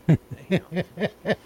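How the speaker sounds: high giggling laugh; high-pitched giggle. Real, not acted. Still laughing hard.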